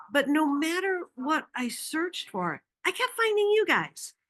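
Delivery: exasperated, pitched high; voicing a customer at wits' end; mildly frustrated. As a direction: customer complaining she keeps finding the same company. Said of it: "she was mildly frustrated".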